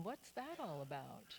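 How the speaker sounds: quiet slow bewildered aside; puzzled wondering. Retold or imitated. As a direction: muse it to yourself, baffled. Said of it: "ended up wondering".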